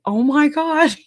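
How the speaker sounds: mock shock at his news; retold surprise. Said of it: "And I was like".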